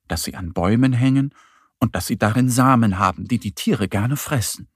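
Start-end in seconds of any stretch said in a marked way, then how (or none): none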